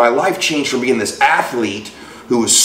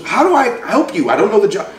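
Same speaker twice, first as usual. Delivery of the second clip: much higher voice imitating a baffled woman; exasperated receptionist; 10 semitones higher, faster than usual. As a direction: throw up your hands as the receptionist, pitched high.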